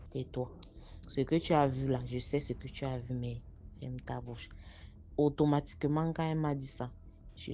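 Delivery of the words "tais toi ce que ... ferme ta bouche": softer, urgent hushing; aunt hushing her niece, softer and urgent; hushed warning. Retold or imitated. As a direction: shush her before anyone hears.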